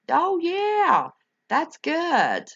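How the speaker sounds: high, bright oh yeah, pleased with the numbers; delighted. Real, not acted. Host pleased to hear the viewer count, delighted. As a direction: lift the voice way up with delight.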